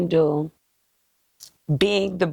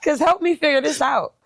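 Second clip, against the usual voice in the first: laughing; 8 semitones higher, 3 dB louder than usual. Real, not acted. Laughing at the quote.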